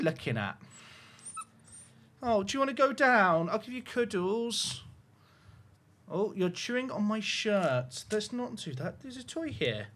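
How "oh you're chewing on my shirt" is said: usual speaking voice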